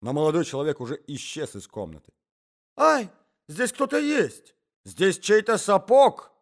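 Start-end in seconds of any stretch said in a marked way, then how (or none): 2.8-6.3 high, loud, slow exclamation; yelp, then cry out the discovery; husband shouting. A narrator's reading. Husband grabbing a stranger's leg, shouting. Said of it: "закричал муж".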